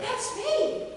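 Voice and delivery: startled cry. Acted. Startled by own double.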